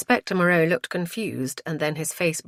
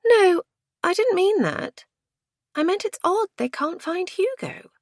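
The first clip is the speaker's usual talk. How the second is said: high, flustered clarification; protesting woman. A narrator's reading. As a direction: correct her in a high-pitched voice. Woman corrects her friend.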